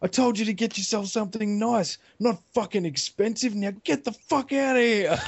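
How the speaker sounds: high-pitched, furious mock rant; instantly angry. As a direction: snap into an acted rage and order her off. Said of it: "like, instantly angry".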